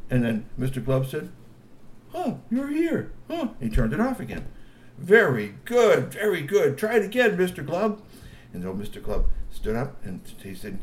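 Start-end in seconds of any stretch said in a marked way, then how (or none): 2.1-3.5 high surprised troll voice. A narrator's reading. Mister Glove sees recording. 5.0-8.0 high encouraging G P voice. A narrator's reading. G P praising.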